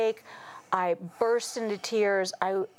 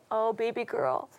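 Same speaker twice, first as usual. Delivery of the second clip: God's voice; 4 semitones higher than usual. Retold or imitated. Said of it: "He was like".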